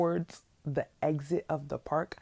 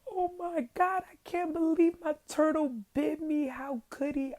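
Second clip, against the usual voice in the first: imitated upset kid, much higher; upset disbelief; 12 semitones higher than usual. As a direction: squeak out the betrayal in a much higher voice. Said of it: "I was so upset".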